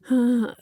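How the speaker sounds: a loud, weary sigh through the nose; exasperated sigh. Retold or imitated. Mom at bedtime, weary sigh at the stubborn kid. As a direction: let the breath out like you're already tired of this. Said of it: "And I'm like".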